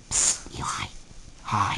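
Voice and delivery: soft secret whisper. Real, not acted. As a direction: whisper it quietly. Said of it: "Why are we whispering?".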